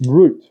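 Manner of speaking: "route" pronounced like "root"; correct Aussie route. Shown as a demonstration. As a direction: demonstrate "route" with a long oo. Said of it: "That's the word you're after".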